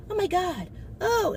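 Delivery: high, whiny mock-horrified cry; a hammed-up gasp of disgust, pitched high; mock horror. Mocking the man's shock, high whiny mock cry. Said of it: "And he looking like".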